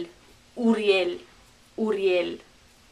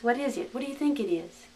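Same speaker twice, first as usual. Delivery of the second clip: usual speaking voice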